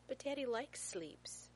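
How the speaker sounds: put-on high, slow little-boy voice; protesting small child. A narrator's reading. Small son at mattress shop objects, childlike protest. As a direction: plead it high and drawn out, childlike.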